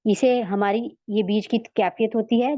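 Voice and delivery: ordinary talk, nothing marked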